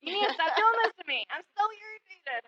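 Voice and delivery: mock complaining to God, half-laughing; exasperated prayer. Teacher retelling her frustrated talks with God, mildly exasperated. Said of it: "there were many times it's like".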